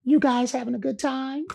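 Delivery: gentle high amused question. Retold or imitated. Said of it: "He said".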